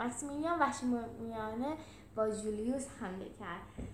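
ordinary delivery, nothing marked

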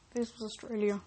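plain reading voice

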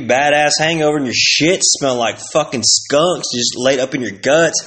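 ordinary talk, nothing marked

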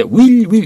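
"will" with a clear "ee" and held L; Korean-style "will", every sound pronounced; overpronounced will. Retold or imitated. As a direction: mimic learners' full, drawn-out "will". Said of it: "이렇게 하시는데 그렇게 하지 마시라고".

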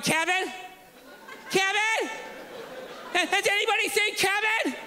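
hammed-up mother calling out in the park; a mom pretending to panic over her missing son; mock panicked mom. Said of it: "Sitting there freaking out".

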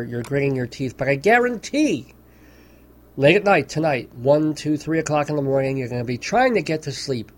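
ordinary delivery

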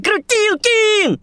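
long high calling out; drawn-out call. Acted. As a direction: stretch the name to call him. Inviting friend to sit.

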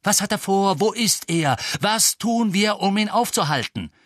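high, loud urgent questions; angry demands. A narrator's reading. Harry angrily demands what Voldemort plans. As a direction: hammer them with questions.

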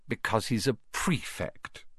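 soft, proud motherly tone; fond. A narrator's reading. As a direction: say it fondly, quietly proud. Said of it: "said their mother fondly".

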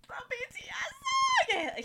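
high-pitched hammed-up crying; exaggerated tearful squeal, drawn out; mock sobbing wail. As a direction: wail it out slowly through fake sobs. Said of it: "heavy sobbing, like, I'm red, crying".

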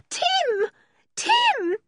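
very high-pitched cry; piercing desperate call; frantic calling. A narrator's reading. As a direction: call out the name in panic. Searching frantically for her dog.

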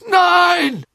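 high, loud cry of denial; desperate shout. A narrator's reading. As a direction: yell it while straining at chains. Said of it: "rief Karkaroff und zerrte an den Ketten".

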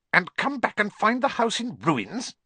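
high scornful snarl in an aunt voice; sharp high snarling aunt. A narrator's reading. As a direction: spit it out as a snarl. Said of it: "she snarled".

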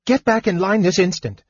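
put-on high-pitched bossy girl scolding; big-sister telling-off; shocked oldest girl. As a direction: order a child back, pitched high. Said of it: "cried the oldest girl, shocked".

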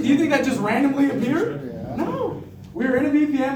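ordinary talk

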